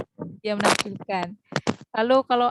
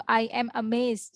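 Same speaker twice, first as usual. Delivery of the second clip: slow teacher demo of "I am amazed"; careful classroom example; slow model sentence; slower than usual. Shown as a demonstration. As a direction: say "I am amazed" slowly for learners. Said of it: "Jadi misalkan".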